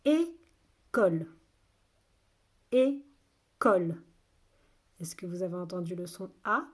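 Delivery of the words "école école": slow, loud, separated syllables. Shown as a demonstration. Teacher has children listen for A, syllable by syllable. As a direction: sound the word out in two beats. Teacher sounding out word for pupils.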